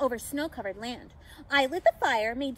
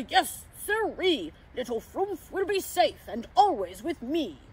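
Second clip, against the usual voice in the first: Santa; 3 dB louder than usual. A narrator's reading. Santa promising to keep the pixie safe, hearty. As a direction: declare it with jolly conviction. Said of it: "said Santa".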